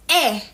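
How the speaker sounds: EH as an open, short "eh"; grave-accent E. Shown as a demonstration. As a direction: open up EH as in "bet". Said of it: "it is pronounced as".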